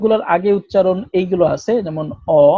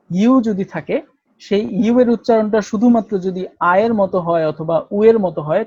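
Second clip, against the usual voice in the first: ordinary talk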